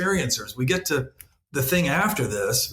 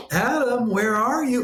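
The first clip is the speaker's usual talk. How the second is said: put-on raised, gently calling voice of God searching for a child; 8 semitones higher than usual. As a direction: call out like a playful father in hide and seek. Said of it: "God walks in and goes".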